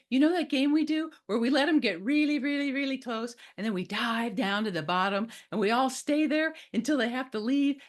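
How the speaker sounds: put-on excited little kid, high-pitched and gushing; Esmeralda. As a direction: pester a parent eagerly like a thrilled child. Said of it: "Esmeralda came up and said".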